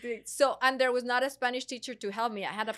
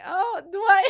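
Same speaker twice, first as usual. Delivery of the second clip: high, loud mock surprise; surprised; 9 semitones higher, 9 dB louder than usual. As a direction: play innocent, pitched way up. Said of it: "I was like".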